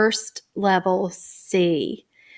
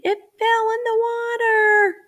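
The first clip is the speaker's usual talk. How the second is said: put-on higher, childlike answer; imitating child answer; 14 semitones higher than usual. Teacher voicing students, childlike answer. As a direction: voice a child's reply. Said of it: "I hope they're saying".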